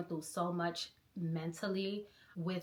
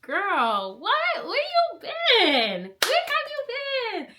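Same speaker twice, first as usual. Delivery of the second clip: surprised greeting; 12 semitones higher, 7 dB louder, slower than usual. Real, not acted. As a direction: drag out the disbelief. Friend greets returning vlogger.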